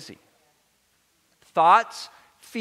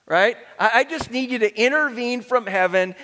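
ordinary talk, nothing marked

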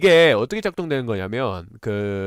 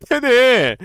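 high delighted laugh, then louder; surprised laugh; 11 semitones higher, 6 dB louder, much slower than usual. Real, not acted. Surprised it works. (놀란 웃음)

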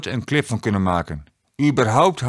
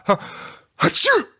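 a squeaky exploding sneeze; hammed-up sneeze breaking the sentence; 12 semitones higher than usual. A narrator's reading. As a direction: sneeze shooting up very high. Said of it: "Sinterklaas moest heel erg proesten".

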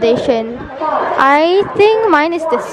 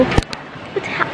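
stunned whisper; 4 semitones higher, 10 dB quieter, much slower than usual. Real, not acted. Shocked at pillow price.

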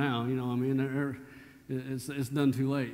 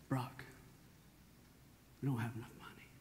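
soft, low-volume worried whisper-like plea; hushed wife; 3 semitones lower, 15 dB quieter than usual. Retold or imitated. Wife quietly worrying over money at theater. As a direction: quietly warn him about the money. Wife voiced in retold scene.

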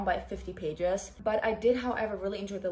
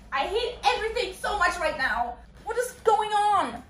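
high, whining outburst; distraught wail; 10 semitones higher than usual. Real, not acted. Reader hating everything right now, distraught wail. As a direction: wail it, overwhelmed and bewildered.

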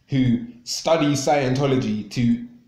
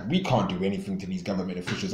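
mocking quote; 6 semitones lower, 6 dB quieter, faster than usual. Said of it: "It's just like".

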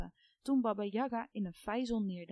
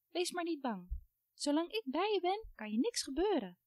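reassuring little doll; 7 semitones higher than usual. A narrator's reading. Doll comforts the girl.

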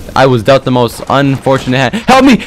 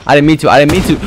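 ordinary talk

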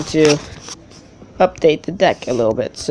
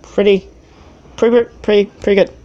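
giddy repeating; 6 semitones higher, 4 dB louder than usual. Real, not acted. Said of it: "I don't know why I said pretty all that much".

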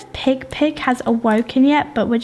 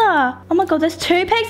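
ordinary delivery

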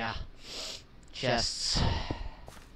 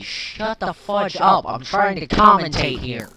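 annoyed yell; 5 semitones higher, 10 dB louder than usual. Real, not acted. Gamer yelling at a noisy zombie, annoyed. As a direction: snap at the zombie to shut up.

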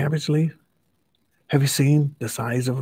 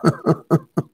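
high staccato giggle; story-character giggle; acted giggle; 5 semitones higher than usual. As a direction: giggle in a quick, high burst. Reader acts out the giggling.

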